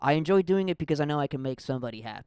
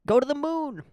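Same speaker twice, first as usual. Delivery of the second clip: much higher and louder, soaring with fake excitement; mock hype; 13 semitones higher, 6 dB louder than usual. Mocking his own dreams of fame, hammed-up soaring hype. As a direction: shoot the voice up like a rocket.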